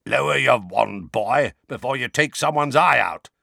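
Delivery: gruff growling warning from the dark. Acted. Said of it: "said a low, growling voice".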